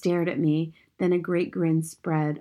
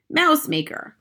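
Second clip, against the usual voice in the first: raised, higher-pitched shout; triumphant shout; 9 semitones higher, 4 dB louder, slower than usual. A narrator's reading. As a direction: shout it out as the answer. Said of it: "my grandmother shouted".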